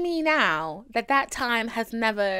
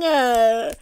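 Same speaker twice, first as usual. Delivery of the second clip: normal talking voice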